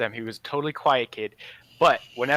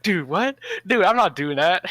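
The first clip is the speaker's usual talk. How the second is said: higher, louder mock protest; incredulous refusal; 6 semitones higher, 5 dB louder than usual. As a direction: act out a baffled kid refusing a dare. Retold refusal of a whispered dare.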